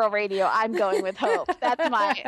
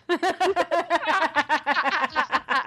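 laughing; 5 semitones higher than usual. Real, not acted. Laughing at the Eve bit.

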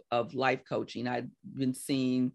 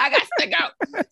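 high, loud comic declaration; playful, raised-voice insistence; urgent exit; 7 dB louder than usual. Retold or imitated. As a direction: announce it like you're bolting for the door. Retelling her urge to quit.

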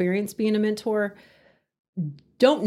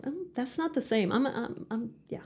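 soft, let-down mutter to oneself; muttered disappointment; 7 dB quieter than usual. Retold or imitated. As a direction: back out quietly, trailing into a near-whisper. Acting out a disappointed diner's reaction.

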